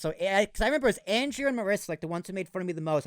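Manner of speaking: normal talking voice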